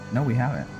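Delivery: ordinary talk, nothing marked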